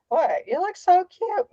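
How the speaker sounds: high-pitched mock cooing; mock gushing. Mother mimicking gushing over a child's costume, high mock cooing.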